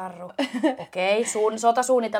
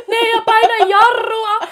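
mock yell; 11 semitones higher, 11 dB louder than usual. Onlookers yelling to brake.

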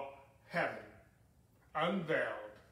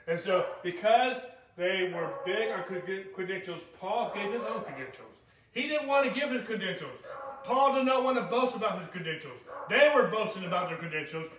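louder, higher, driving sermon voice; rising to a fervent pulpit shout; full-force preaching; 5 semitones higher, 9 dB louder than usual. Acted. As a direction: preach it hard to the congregation.

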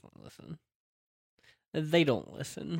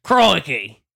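loud Australian "Crikey!" shouted; Australian exclamation; 3 semitones higher, 11 dB louder than usual. Acted. Teasing a friend about speaking Australian, loud Aussie shout.

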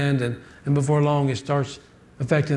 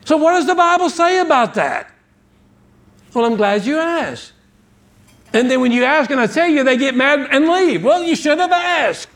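high, raised pulpit voice; fervent preaching; 9 semitones higher, 4 dB louder than usual. Real, not acted. Preacher tells congregation to hear God's word, fervent high preaching.

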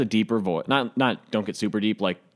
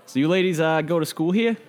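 put-on hidden Boston accent; 8 semitones higher than usual. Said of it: "tries to submerge his thick Boston accent".

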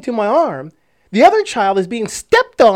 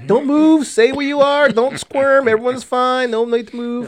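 high, hurried-sounding orders to hold still; raised-pitch mock alarm; urgent warning; 6 semitones higher than usual. Dad orders squirming kids to stay still, urgent warning.

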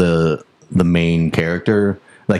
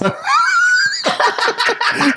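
big laugh. Real, not acted. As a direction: howl with laughter. Laughing at Harrison Ford guess.

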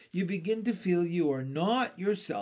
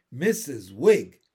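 a thin, louder, slowed indignant echo; 4 semitones lower, 8 dB louder, slower than usual. A narrator's reading. Landlady offended at the name, thin indignant voice. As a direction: sound pinched and offended. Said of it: "in a voice even thinner than herself".

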